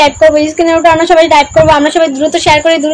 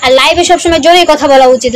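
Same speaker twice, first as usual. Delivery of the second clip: normal talking voice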